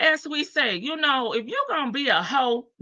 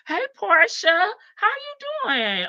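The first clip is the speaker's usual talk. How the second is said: high sing-song greeting; 11 semitones higher than usual. Real, not acted. Greeting a new viewer.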